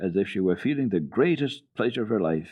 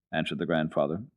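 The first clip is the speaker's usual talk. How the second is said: ordinary delivery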